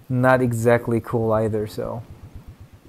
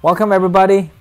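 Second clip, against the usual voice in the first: mock host; 6 semitones higher, 6 dB louder, much faster than usual. Mock host welcome before retry.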